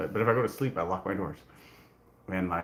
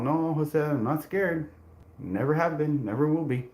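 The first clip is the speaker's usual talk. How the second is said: defiant retort; 6 semitones higher, slower than usual. Retold or imitated. Defiant reply to taunting roommate.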